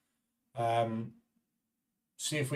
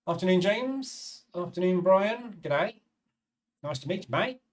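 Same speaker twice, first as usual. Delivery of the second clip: hammed-up Australian accent, pitched higher and slowed; bad Australian accent; 6 semitones higher than usual. Greeting chat viewers in a bad Australian accent. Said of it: "Good Australian accent, eh?".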